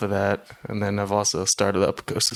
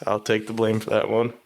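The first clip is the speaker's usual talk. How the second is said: ordinary talk, nothing marked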